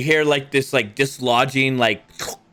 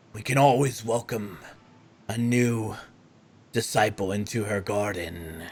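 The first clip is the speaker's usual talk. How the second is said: lightly voiced, lower and softer zealot; cultist voice; 4 semitones lower, 3 dB quieter than usual. Game master voicing the Dark Mother's follower, soft and inviting. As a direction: invite them in like a sweet recruiter.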